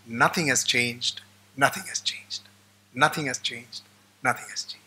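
low, fading resigned repetition; weary old man. Retold or imitated. Old man telling the visitor, resigned and fading. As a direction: sigh it out like a tired old man.

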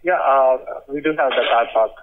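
ordinary talk, nothing marked

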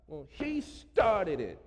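put-on slow whiny kid voice; kindergartner. Pastor mocking grown-ups acting like kindergartners, childish whine. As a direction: sound like a little kid blaming another. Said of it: "We turned into kindergartners".

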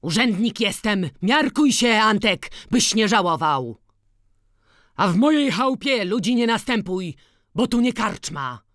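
high, loud yell telling Antek to back off; shouted warning. Acted. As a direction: shout him down in your own house. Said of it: "krzyczał kowal".

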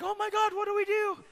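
very high-pitched frightened squeal; mock panic. Other attendants watching the woman cry, panicked squeal.

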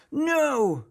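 high, loud defiant "No"; shouting. Acted. Marvin shouting no when Molly says calm down. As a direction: refuse her at full volume. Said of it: "shouted Marvin".

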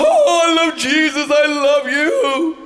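high, hammed-up tearful wail; mock weeping. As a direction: imitate an overemotional worshipper, dragging the last words. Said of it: "others that are weeping willows".

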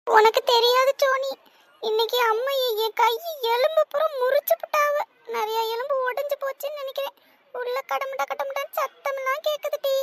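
high, whining tearful complaint; acted crying. Child telling sibling that mother broke her bones, acted crying. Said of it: "ஏன் இப்ப நீ அழுவுறடி?".

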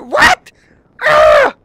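squealed high disbelief; shocked. Real, not acted. Gamer seeing the five hundred price, shrieking.

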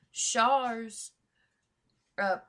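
normal talking voice

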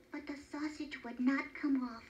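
ordinary delivery

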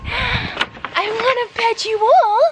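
very high, drawn-out coo. Real, not acted. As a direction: whine lovingly at the dogs, way up high. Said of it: "baby talk your dogs".

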